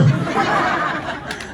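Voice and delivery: a high, airy burst of laughter; breathless laugh. Real, not acted. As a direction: laugh without voice at yourself. Grass stuck in throat.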